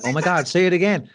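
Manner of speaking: high, loud mock-American gushing; gushing American impression; excited American admirers. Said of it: "And they were like".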